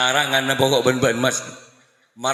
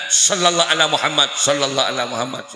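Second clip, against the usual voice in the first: quick recitation; faster than usual. Shown as a demonstration. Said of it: "dek, dek".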